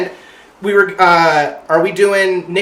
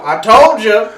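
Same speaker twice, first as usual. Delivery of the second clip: put-on raised, loud country-accented gloat; mock country boast, called out; Larry Bird's country accent; 5 semitones higher, 6 dB louder, slower than usual. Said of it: "has this country-ass accent".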